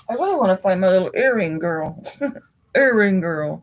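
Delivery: bright, quick, sing-song lift; playful high voice. Pretend. Playful wish while browsing earrings.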